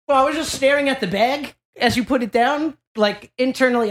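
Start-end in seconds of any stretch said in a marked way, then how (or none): none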